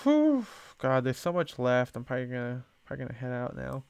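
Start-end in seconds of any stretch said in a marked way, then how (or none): 0.0-0.5 high, loud exhale of fatigue; loud tired whew. Real, not acted. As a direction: blow out a weary whew. Tired sigh at remaining work.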